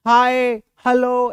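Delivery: very slow, drawn-out lifeless हाय, hello; teacher mocking a bored greeting; wrong way, dull. Shown as a demonstration. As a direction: drag हाय, hello out like you don't care. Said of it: "ऐसे मत बोलना".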